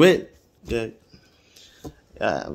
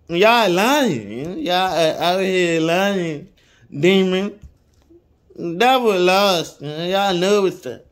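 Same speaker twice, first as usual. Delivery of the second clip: fiery preaching; 4 semitones higher than usual. Acted. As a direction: preach it hard at the crowd. Preaching against killing.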